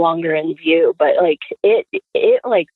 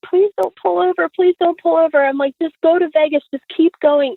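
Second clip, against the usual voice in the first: high-pitched retold begging; acted desperate plea, pitched up; mock hysterical pleading; 8 semitones higher than usual. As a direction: beg like a frightened kid in the back seat. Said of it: "I'm in the back hysterically crying".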